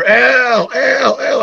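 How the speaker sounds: high called-out name across a crowd; calling out. Retold or imitated. Friend calling across arena.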